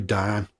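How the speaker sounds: "down" as a long flat "daan". Shown as a demonstration. Podcaster failing to tone it down, "down" as "daan". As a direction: say "down" as "daan", no "ow" glide. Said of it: "we say down".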